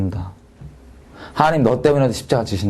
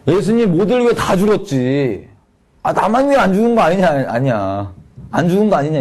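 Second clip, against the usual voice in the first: mocking kid's doubt; 5 semitones higher than usual. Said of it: "얘가 질문이 이거였어요".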